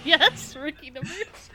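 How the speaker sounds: laughing, loud and high. Real, not acted. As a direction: laugh through the words. Laughing repeat of quote.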